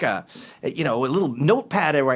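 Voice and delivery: normal talking voice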